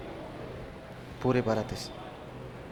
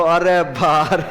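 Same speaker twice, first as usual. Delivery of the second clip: mock exasperated groan; 4 dB louder, much slower than usual. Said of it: "ऐसे sir के और करो".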